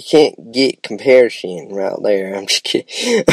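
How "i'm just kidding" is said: high, playful take-back; teasing. Real, not acted. Vlogger teasing viewers, high and playful. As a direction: lightly tease the audience.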